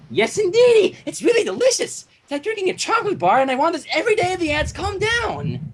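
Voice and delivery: high, gushing and giddy; excited Pinkie Pie gushing; bubbly excited pony. Acted. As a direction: squeal it like a kid on sugar.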